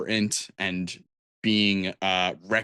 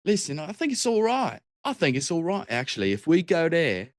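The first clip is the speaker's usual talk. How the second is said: Cockney bard voice, higher; Cockney accent; 6 semitones higher than usual. Shown as a demonstration. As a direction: speak it like a London performer. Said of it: "I speak in a Cockney accent".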